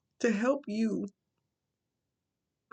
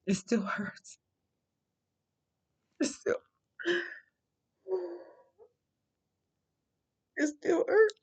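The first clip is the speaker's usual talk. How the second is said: crying; 11 semitones higher than usual. Real, not acted. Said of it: "Yes, I'm crying because I'm human".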